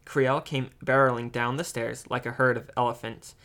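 ordinary delivery, nothing marked

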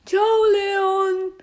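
high, loud parting call; loud goodbye. Acted. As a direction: shout a cheery farewell across the room. Game figure calls goodbye loudly.

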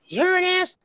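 high-pitched, loud imitation of someone scolding; squealed mock telling-off; mock scolding outburst. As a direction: mimic an outraged friend, voice jumping high. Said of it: "Maggie does this whole".

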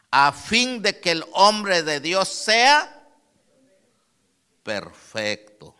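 loud, slow, drawn-out preaching; slow loud pulpit cadence; fervent preaching. Real, not acted. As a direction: hammer each phrase to the congregation. Preaching the verse at full force.

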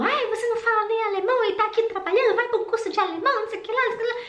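high, fast, scornful imitation of a nagging customer; mocking a rude customer. Imitating a rude customer at the clothes shop, high, fast and scornful. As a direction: toss it off high and fast, mocking her contempt.

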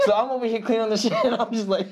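high, fast laughing telling; can't stop laughing while telling it; laughing through the story. Real, not acted. Nurse recounting the mess, laughing high and fast.

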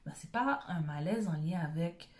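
usual speaking voice

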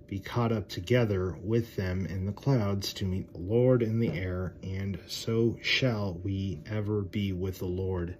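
loud, slow, solemn Bible reading; preacher reading scripture out loud. Acted. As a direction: proclaim the verse slowly to the congregation. Reads scripture aloud.

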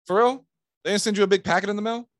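high, loud, incredulous question; feigned disbelief. Acted. Salesman to client about the missing packet, mock disbelief. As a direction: act amazed, pitched right up.

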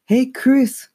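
high-pitched protesting cry. A narrator's reading. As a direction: cry out as the gum bursts on you. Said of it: "cried as the pink bubble gum exploded".

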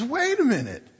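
high-pitched, incredulous protest; astonished onlooker. Acted. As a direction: jump in amazed on the dog's behalf. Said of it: "the guy that's been watching this says".

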